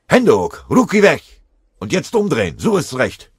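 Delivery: full, high shout at a prisoner; loud military bellow; roared command. A narrator's reading. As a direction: yell orders to surrender. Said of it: "Eine ihm bekannte Stimme brüllte".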